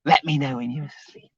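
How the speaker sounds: hushed mock-creepy coaxing; creepy uncle. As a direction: imitate a creepy uncle, barely audible. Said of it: "the creepy uncle".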